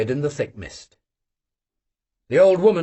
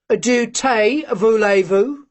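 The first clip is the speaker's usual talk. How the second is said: grinning; 5 semitones higher, 3 dB louder, much slower than usual. Acted. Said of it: "asked Vanyusha, grinning".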